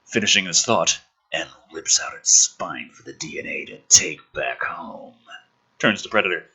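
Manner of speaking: normal talking voice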